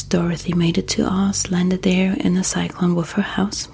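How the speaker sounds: ordinary delivery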